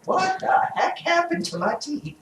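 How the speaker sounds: high, startled patient exclaiming; alarmed little gasp of a question; surprised. Retold or imitated. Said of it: "that morning when you go".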